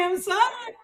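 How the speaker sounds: high, hammed-up sobbing down the phone; mock crying. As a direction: wail it like a tearful caller. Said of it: "I called my sponsor crying and crying".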